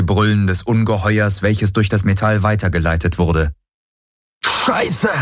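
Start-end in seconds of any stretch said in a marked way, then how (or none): 4.4-5.1 high yell against the noise; shouted curse. A narrator's reading. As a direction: cry out a curse over the din. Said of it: "schrie Garland gegen den Lärm". (hoher Aufschrei gegen den Lärm)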